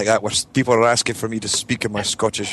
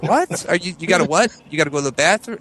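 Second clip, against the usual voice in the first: high-pitched, puzzled questioning; mock confusion, guessing at the meaning; acted bafflement; 7 semitones higher than usual. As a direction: pitch it up as if nothing makes sense. Acting out baffled reply to relatives.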